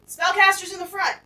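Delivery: loud, high battle shout; screaming out. Acted. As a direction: scream a warning across the battlefield. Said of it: "screaming out".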